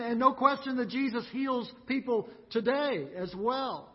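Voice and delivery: normal talking voice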